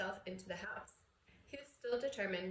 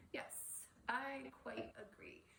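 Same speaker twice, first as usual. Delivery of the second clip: whispered, much softer, slow; sinking heart; 4 semitones higher, 9 dB quieter, slower than usual. Acted. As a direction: agree as your heart sinks. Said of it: "his heart sinking horribly".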